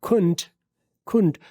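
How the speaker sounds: खुंट, couldn't said as khunt; "खुंट" one short syllable; reduced couldn't. Shown as a demonstration. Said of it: "could not का reduction होगा".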